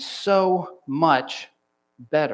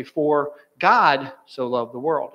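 "God" punched louder and held; stress on GOD; 3 dB louder, slower than usual. Shown as a demonstration. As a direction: hit the second word, "God", hard. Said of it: "emphasize every word of the passage".

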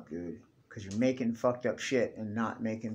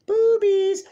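very high, loud shouted outburst; excited shout; 10 dB louder than usual. Real, not acted. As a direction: blurt it out at the top of your voice.

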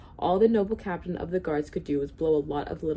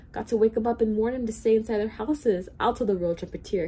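raised, urgent storytelling shout; 5 semitones higher, 5 dB louder than usual. A narrator's reading. Bartholomew resolves to warn everyone, shouting lightly. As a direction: push it out with mild urgency. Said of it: "he shouted".

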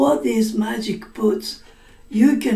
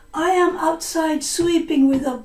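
high daughter voice; 6 semitones higher than usual. Acted. Blood speaks as the daughter.

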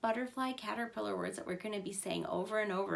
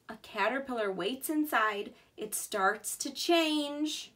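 slow storytelling; 3 dB louder than usual. Acted. Reading to children.

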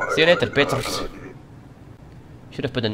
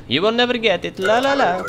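taunting sing-song; 10 semitones higher, 8 dB louder than usual. Pretend. Taunting the entrant.

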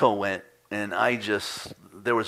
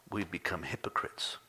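whispered punchline; 3 semitones lower, 10 dB quieter than usual. Acted. Preacher whispering the quote's end to the congregation. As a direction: drop to a breathy whisper.

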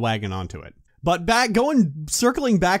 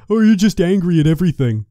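louder, dismissive put-on voice of a critic; mock accusing tone; 3 semitones higher, 6 dB louder than usual. Mocking dishonest viewers who call him angry, in a louder dismissive voice. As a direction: sneer it like a lazy accuser. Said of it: "to come off with the tone of".

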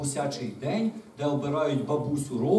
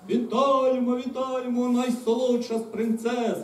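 high, drawn-out cheer of welcome; glad, sustained high greeting; joyful calling; 8 semitones higher, slower than usual. A narrator's reading. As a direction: hail a beloved princess in chorus style. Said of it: "радо загукали дракони".

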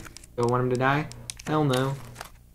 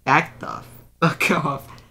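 laughing retort; 3 dB louder, slower than usual. Retold or imitated. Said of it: "I said".

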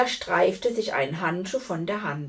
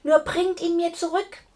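higher, urgent pleading from a queen; eager call; 7 semitones higher, 3 dB louder than usual. A narrator's reading. Queen begging the knight to save her son, urgent high call. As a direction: call out eagerly, pitch jumping up. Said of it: "rief die Königin".

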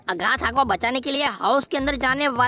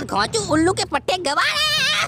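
whiny taunt; 10 semitones higher than usual. Acted. Taunting game villain, whiny.

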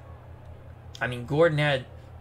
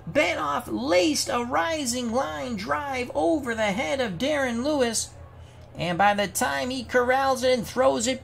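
higher-pitched baseball announcer call; live play-by-play; 8 semitones higher than usual. Pretend. Announcer calling a tying triple for the Yankees, live play-by-play. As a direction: call the big hit like a radio broadcaster.

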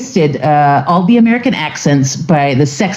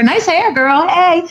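high, chirpy praise to a stranger; bubbly compliment; 10 semitones higher, slower than usual. Retold or imitated. Retelling how American women compliment strangers, high and chirpy. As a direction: toss it out like a passing cheer.

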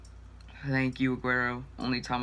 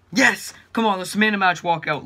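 excited shout; 4 semitones higher, 7 dB louder than usual. Real, not acted. Walkout celebration.

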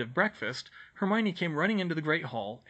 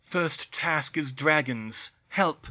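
words drawn out slowly, last one louder; slow urgent reading; 4 dB louder, much slower than usual. Acted. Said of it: "The coin read".

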